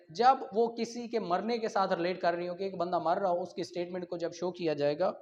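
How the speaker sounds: ordinary talk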